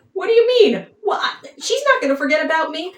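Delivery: high, loud mock indignation; upset. Upset lover learning he may be replaced, high indignant outburst. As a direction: act out a rattled lover in rapid, high protest. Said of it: "he is obviously upset at this news".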